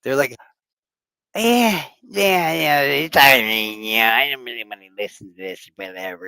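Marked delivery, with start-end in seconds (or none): 1.4-6.2 hesitant, uneasy mock reply; awkward squirming. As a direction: hem and haw awkwardly. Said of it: "they start squirming and stuff".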